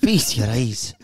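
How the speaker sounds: jokey put-on Gibraltar accent. Voicing a Gibraltar chicken for laughs, Gibraltar accent. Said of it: "they've got a super accent".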